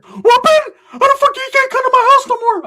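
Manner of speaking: outraged squeaky sidekick yelling; Ricky's shout. Retold or imitated. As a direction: yell it up in a squeaky falsetto. Ricky shouts in retold story.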